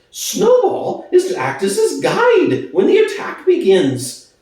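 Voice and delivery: a jittery pig spreading panic; nervous pig voice. Acted. Squealer warning the animals of the attack, high alarmed squeak. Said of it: "cried Squealer, making little nervous skips".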